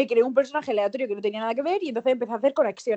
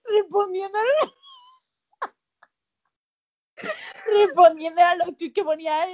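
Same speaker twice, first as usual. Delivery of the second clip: laughing; 7 semitones higher than usual. Real, not acted. Laughing while telling.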